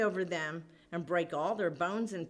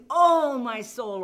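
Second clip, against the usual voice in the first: loud, drawn-out acted lament; a slow, hammed-up groan of woe; woeful exclamation; 7 dB louder, slower than usual. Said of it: "it was a woeful voice".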